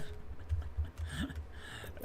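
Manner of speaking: soft, voiceless laugh; chuckle. Real, not acted. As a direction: chuckle softly to yourself. Laughs at own joke.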